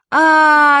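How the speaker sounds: long, high, loud "aaah"; drawn-out yell from above; children's yell. A narrator's reading. Children yelling down at falling mother, long high scream. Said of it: "орали мы ей сверху".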